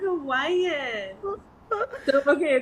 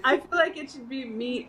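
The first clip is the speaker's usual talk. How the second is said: ordinary talk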